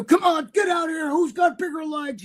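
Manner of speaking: hammed-up bodybuilding emcee; mock host shout. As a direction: bellow it like a cheesy contest host. Mocking a shouting contest host.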